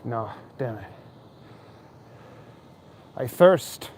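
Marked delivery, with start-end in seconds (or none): 3.2-3.9 mock biblical. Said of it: "In the words of the Lord Jesus Christ".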